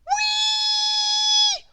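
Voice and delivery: very high shrill whistle imitation; whistle sound. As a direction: screech like a whistle. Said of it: "went the whistle".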